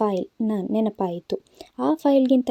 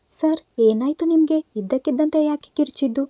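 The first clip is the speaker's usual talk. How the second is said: higher, mock-worried woman's voice; a young woman faking alarm to her boss; feigned alarm; 6 semitones higher than usual. A narrator's reading. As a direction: fake panic, raised pitch, pretending concern. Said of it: "ಗಾಬರಿಯಾದಂತೆ ನಾಟಕ ಶುರು ಮಾಡಿದಳು".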